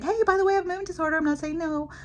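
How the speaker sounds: high-pitched, put-on casual disclosure; mock breezy announcement. Speaker pretends telling strangers about her disorder, mock breezy. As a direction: blurt it as an awkward icebreaker.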